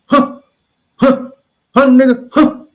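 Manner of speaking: loud, demanding huh again and again; loud taunting huh. Real, not acted. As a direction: bark it out as a challenge.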